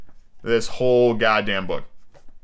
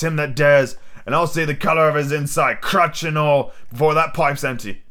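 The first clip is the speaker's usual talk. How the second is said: higher-pitched, defiant pirate captain; Long John Silver, indignant; 4 semitones higher than usual. Acted. Silver dares any man to take a cutlass, indignant pirate. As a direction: challenge them like an outraged old seadog.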